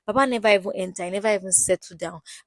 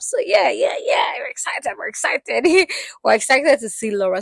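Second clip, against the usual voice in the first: high, loud excited yells with a laugh; excited shout; 5 semitones higher, 5 dB louder than usual. Real, not acted. Vlogger cheering Laura's arrival with a high, loud shout. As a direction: cheer it out, bursting with hype.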